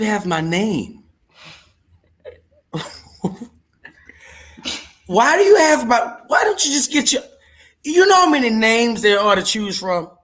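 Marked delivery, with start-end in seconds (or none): 5.1-10.1 much higher, louder indignant rant building to a yell; outraged disbelief. Real, not acted. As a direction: scold the copycat in mock outrage. Scolds impostor with his name.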